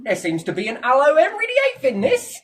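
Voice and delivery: hammed-up English accent, high and loud; attempted English accent. Shown as a demonstration. Showing the listener an accent, loud hammed-up English accent. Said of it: "I can't even do an English accent".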